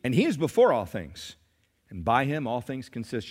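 ordinary talk, nothing marked

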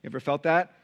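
usual speaking voice

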